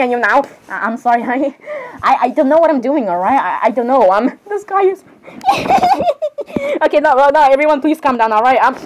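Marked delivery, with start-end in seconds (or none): 5.4-6.8 a squeaky, high-pitched burst of laughter; high giggle. Acted. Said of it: "Dad, you just laughed in this situation".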